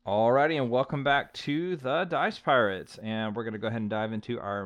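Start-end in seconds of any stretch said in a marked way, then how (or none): 0.0-3.0 podcast host intro. Acted. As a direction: welcome listeners like a host.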